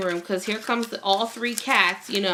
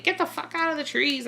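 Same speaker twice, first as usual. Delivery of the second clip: mock yell; 7 semitones higher, 3 dB quieter than usual. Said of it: "I'm, like, yelling".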